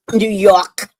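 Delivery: New York accent on "New York". Shown as a demonstration. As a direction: say "New York" as "Noo Yawk". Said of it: "I add on top of the New York".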